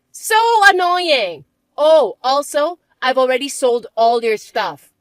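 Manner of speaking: slow, loud and mocking; bratty stepsister. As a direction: drag out the taunt.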